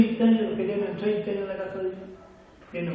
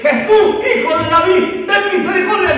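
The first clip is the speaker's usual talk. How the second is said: high, very loud cry for mercy; desperate shout; 14 semitones higher, 15 dB louder than usual. Acted. Blind man crying out to Jesus for mercy, shouting. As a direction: shout it out as a plea to be heard. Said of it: "empieza a gritar".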